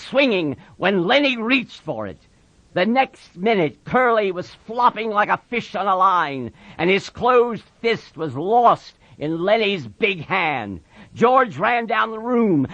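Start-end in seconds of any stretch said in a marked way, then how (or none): none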